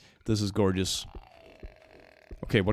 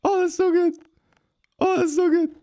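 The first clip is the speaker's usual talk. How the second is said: much higher, louder squeal of delight; 7 dB louder than usual. Real, not acted. Streamer thrilled by creature part, squeals high and loud. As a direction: squeal it like the best surprise ever.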